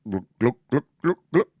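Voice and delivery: short glugs rising in pitch; pouring noise. Acted. Game master pouring the dwarven drink, imitated glugging. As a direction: mimic liquid glugging out of a bottle.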